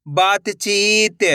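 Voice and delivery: बातचीत very slow, syllables stretched; blended syllable reading. Shown as a demonstration. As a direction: draw out बातचीत for learners. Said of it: "एक अक्षर का उच्चारण सही से". (बातचीत को धीरे से खींचकर)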